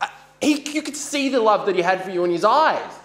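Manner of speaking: loud, high, desperate pleading; crying out in a fight; anguished shouting. Acted. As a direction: shout it through rising panic. Heated breakup fight, pleading.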